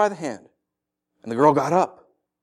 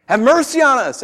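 raised, high call for mercy; shouted plea; 10 semitones higher, 6 dB louder than usual. Acted. Blind men calling after Jesus and his disciples, shouting. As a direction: call after someone who isn't stopping. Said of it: "they're shouting at him".

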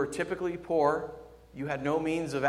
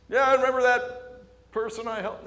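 high, loud put-on bargaining voice; mock entitlement; 10 semitones higher, 6 dB louder than usual. Preacher parodying someone reminding God of good deeds, mock entitlement. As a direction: push it as an exaggerated claim of credit.

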